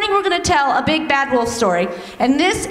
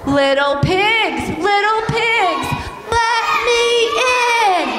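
wolf call; 7 semitones higher, slower than usual. Acted. Wolf shouting at the brick house, higher. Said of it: "the wolf says".

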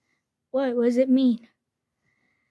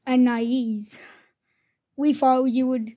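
usual speaking voice

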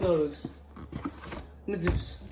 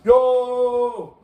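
shout; 6 semitones higher, 11 dB louder than usual. Real, not acted. Calling out to friend.

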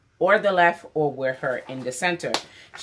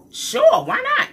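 very high, loud squeal; eager yes; 8 dB louder, slower than usual. Retold or imitated. She eagerly accepts her sister's collab offer, squealing. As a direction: squeal the yes like it's a dream come true.

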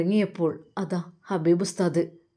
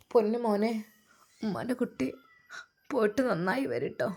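a mother choking up as her son leaves; tearful mother; 2 semitones higher, 6 dB quieter than usual. Acted. Mother farewelling her son abroad, softly tearful. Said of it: "ഉമ്മ വിതുമ്പി കരഞ്ഞു".

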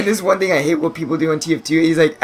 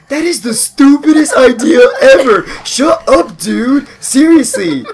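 higher, louder fake outrage; mock angry yell; 10 semitones higher, 7 dB louder, slower than usual. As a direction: yell at the troll in mock anger. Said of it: "I'm like".

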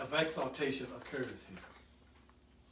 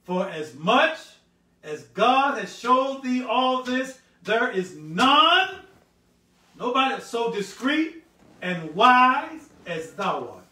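loud, slow, high scripture proclamation; stretched-out, booming Bible reading; 9 semitones higher, 15 dB louder, slower than usual. Shown as a demonstration. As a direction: draw each phrase out with full pulpit force. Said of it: "I'm gonna read it slow".

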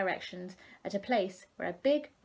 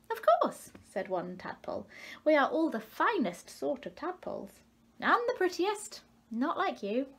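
high, smug tadpole voice; proud tadpole; 7 semitones higher than usual. A narrator's reading. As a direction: brag like a vain little tadpole. Tadpole boasts.

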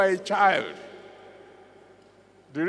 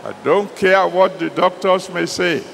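loud, urgent declaration; preaching at full force; 6 dB louder than usual. Acted. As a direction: proclaim it over the crowd.

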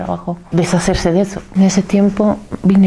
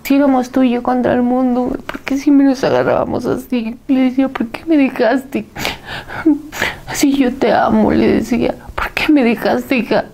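high, tearful pleading to her lost daughter; crying plea; 6 semitones higher than usual. Retold or imitated. Mother sobbing over her daughter's body, high tearful pleading. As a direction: beg through tears, voice strained high. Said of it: "rompí en llanto".

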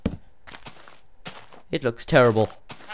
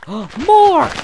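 much higher, louder gasp of joy; excited shriek; 14 dB louder than usual. Real, not acted. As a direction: shriek with delight at the find. More clay found, shrieks.

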